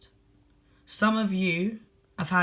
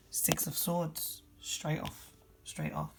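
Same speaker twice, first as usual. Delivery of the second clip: hushed card reveal; 8 dB quieter, slower than usual. Real, not acted. Card drawn, quiet reveal.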